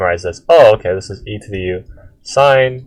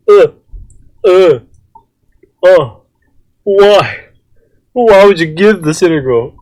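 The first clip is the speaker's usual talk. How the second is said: exasperated disbelief; 9 semitones higher, 6 dB louder than usual. Real, not acted. Outraged at a hard integral.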